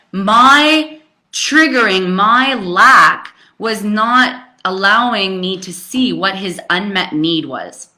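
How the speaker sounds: ordinary talk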